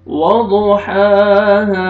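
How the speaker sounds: वदुहाहा, stretch the last हा slowly. Shown as a demonstration. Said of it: "एक अलिफ के बराबर खींचना है". (वदुहाहा में आख़िरी हा एक अलिफ़ के बराबर खींचें)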